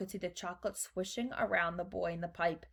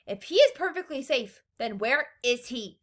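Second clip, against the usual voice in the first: high, loud, impatient challenge; snapped; 4 semitones higher, 6 dB louder than usual. A narrator's reading. Mother demands to know where her son is, snapped. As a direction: snap the question at him. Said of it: "snapped Missus Gloop".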